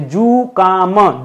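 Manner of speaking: जुकाम drawn out very slowly with a plain क, no ख; very slow, careful जुकाम. Shown as a demonstration. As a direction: stretch जुकाम so the क is clear. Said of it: "जुकाम होना चाहिए".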